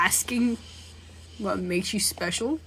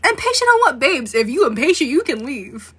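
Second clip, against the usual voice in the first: sassy brush-off; 5 semitones higher, 7 dB louder than usual. Pretend. Sassy reply to the killer.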